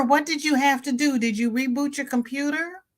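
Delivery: usual speaking voice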